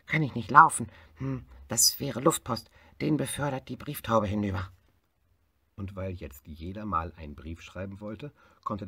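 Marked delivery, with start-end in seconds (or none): 0.1-4.7 higher and louder, busy hare; a hare brushing off the long trip; fast hare voice. Acted. Hare tells elephant Africa is too far, louder.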